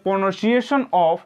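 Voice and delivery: each word drawn out for note-taking; slow classroom dictation. Shown as a demonstration. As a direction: read it slowly so the class can write. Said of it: "उच्चारण कैसे किया जाता है".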